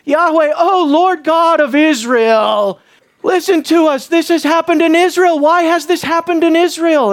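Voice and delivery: hammed-up solemn cry to the Lord; mock-official invocation. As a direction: proclaim it grandly, as an official plea. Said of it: "this is like an official".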